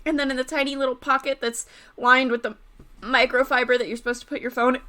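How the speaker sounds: normal talking voice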